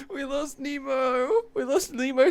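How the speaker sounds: high, crying-tinged mock lament; fake-weep over the loss; mock sobbing. Streamer mock-mourning a friend lost to videos, crying voice.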